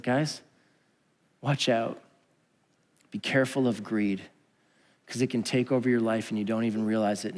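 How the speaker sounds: gentle warning. Retold or imitated. Jesus gently warning the crowd about greed, softly. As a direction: gently warn them, like a caring teacher. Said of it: "so lovingly and gently calling out".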